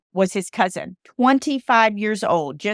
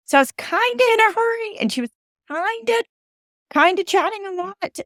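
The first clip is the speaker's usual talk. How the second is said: high pitched; 13 semitones higher than usual. Real, not acted. Pitch jumps high on hurry.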